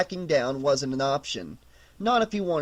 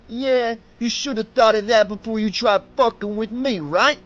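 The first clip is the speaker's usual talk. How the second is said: higher, louder menacing thug; thug threatening; 6 semitones higher, 5 dB louder than usual. A narrator's reading. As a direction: spit the threat in his face. Thug mocking.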